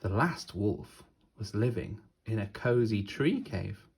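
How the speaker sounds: ordinary delivery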